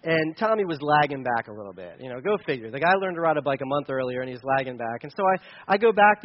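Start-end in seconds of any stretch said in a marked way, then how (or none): none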